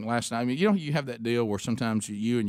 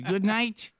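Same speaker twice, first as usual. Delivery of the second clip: higher-pitched imitated French accent; little French accent, lifting at the end; 9 semitones higher than usual. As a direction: mimic a French boy's goodnight. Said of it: "little French accent".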